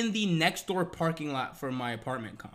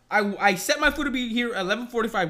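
ordinary talk

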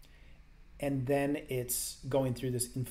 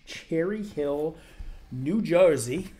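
slow, louder, hammed-up New Jersey accent on the place name; bad New Jersey accent; 3 semitones higher, 6 dB louder, much slower than usual. Reading a viewer's hometown in a jokey New Jersey accent. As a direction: drag out Cherry Hill in a bad Jersey accent. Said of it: "that was a terrible New Jersey accent".

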